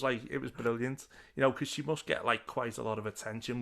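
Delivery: normal talking voice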